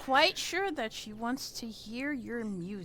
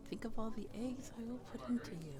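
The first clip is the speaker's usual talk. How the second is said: very soft, quick murmur; hushed, hurried aside to calm her; murmured into her ear; 12 dB quieter, much faster than usual. Acted. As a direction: drop your volume right down, intimate and quick. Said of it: "whisper something into her ear".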